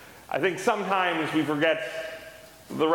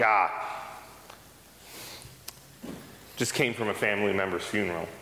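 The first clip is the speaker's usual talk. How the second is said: lower, grumbling coworker reply; gruff coworker; 6 semitones lower, 5 dB quieter than usual. Retold or imitated. Telling a coworker in the locker room, a low grumble. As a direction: grunt it out like a fuming coworker. Coworker Thomas answers, fuming.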